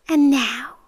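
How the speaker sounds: usual speaking voice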